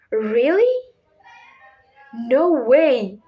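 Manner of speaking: mock-shocked, big swing in pitch; shocked amazement. Shown as a demonstration. Teacher giving examples of strong feelings, shocked and amazed. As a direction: react in amazed disbelief. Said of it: "strong feelings such as shock".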